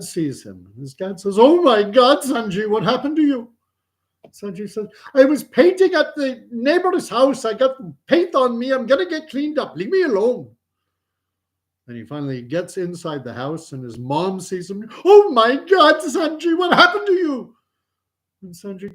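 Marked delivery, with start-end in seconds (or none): 1.4-3.5 high, exclaimed alarm; shocked dad. Acted. Acted shocked dad in joke. 5.1-10.5 high-pitched, fed-up protest; exasperated son. Acted. Acted exasperated son brushing dad off. 15.0-17.6 very high, loud shriek of alarm; shocked mom. Acted. Acted shocked mom in joke.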